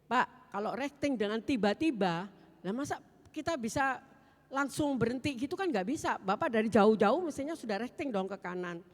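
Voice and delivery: loud retold argument, rising in pitch; heated rebuttal. Woman disputes the driver's signalling, heatedly. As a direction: push back forcefully, as in a quarrel.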